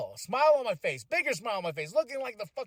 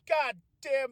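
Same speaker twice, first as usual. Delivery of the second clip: normal talking voice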